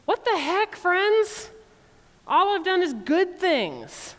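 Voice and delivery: high, loud exasperated protest; God scolding his ungrateful people, high and exasperated; indignant God. Retold or imitated. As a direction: throw up your hands at ungrateful friends. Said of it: "God says".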